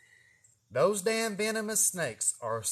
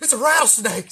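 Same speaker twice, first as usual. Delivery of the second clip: proud announce; 7 semitones higher, 9 dB louder than usual. Retold or imitated. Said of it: "I was like".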